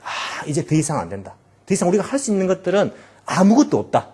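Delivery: ordinary delivery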